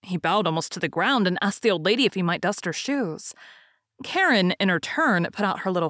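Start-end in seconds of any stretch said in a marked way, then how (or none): none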